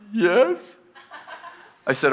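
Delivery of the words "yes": high small teary yes; teary assistant. Retold or imitated. Crying assistant answers her pastor, high and teary. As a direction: squeak it out like someone near tears.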